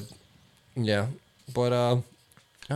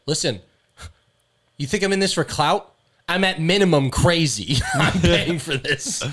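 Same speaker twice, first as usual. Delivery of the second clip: mock indignant; 5 semitones higher, 4 dB louder than usual. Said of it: "she's like".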